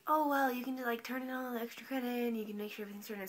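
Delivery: lightly put-on teacher voice; hint of a mimicked teacher; teacher impression. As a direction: imitate the teacher giving easy advice. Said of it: "that's what she sounds like".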